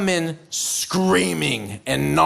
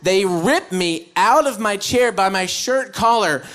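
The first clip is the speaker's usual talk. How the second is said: usual speaking voice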